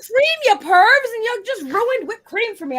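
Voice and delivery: indignant protest. Real, not acted.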